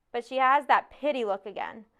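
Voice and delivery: ordinary delivery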